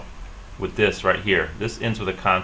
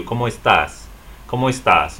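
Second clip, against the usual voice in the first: estás stressed on -TÁS, a bit louder; accented final syllable; 3 semitones higher, 4 dB louder than usual. Shown as a demonstration. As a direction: say ¿Cómo estás? with weight on TÁS. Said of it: "has an accent mark over this a".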